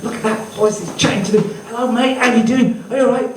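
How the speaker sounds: high, bright chatter to a monkey; excited chatting. Retold or imitated. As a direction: coo at the monkey like a proud entertainer.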